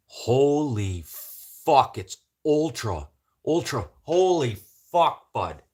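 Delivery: loud, high burst of delighted swearing; excited shout. Real, not acted. Streamer greeting a new viewer in a loud, high, excited shout. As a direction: yell your surprise at a rare visitor.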